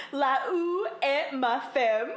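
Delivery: slow, drawn-out French title with an amused edge; aggressive French imitation. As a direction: attempt aggressive French, half laughing. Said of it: "he says it so aggressively".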